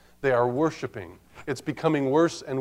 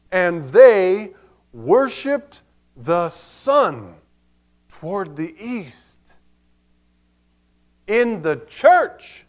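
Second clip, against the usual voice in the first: emphatic preacher, pitched up and louder; forceful preaching; 5 semitones higher, 6 dB louder than usual. Acted. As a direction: drive each phrase home to the congregation.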